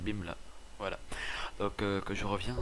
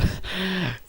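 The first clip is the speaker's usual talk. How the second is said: short laugh; 11 semitones higher, 8 dB louder than usual. Real, not acted. Laughs at repeating a year.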